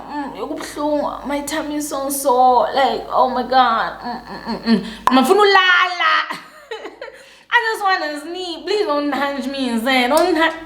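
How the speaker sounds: high, hammed-up whimpering; mock moaning. Faking illness in bed beside her man, theatrical moaning. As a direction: moan pitifully, faking a sore tummy. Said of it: "and I was moaning".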